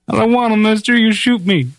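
high, scared little plea; pleading sheep herder. Retold or imitated. Sheep herder begs the gunfighter not to draw, high frightened plea. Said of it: "the sheep herder's feet".

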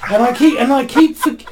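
high-pitched, loud comic protest; high, hammed-up complaint on the edge of laughter; joking exasperation. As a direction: play up the height joke, voice climbing high. Joking about the short prime minister.

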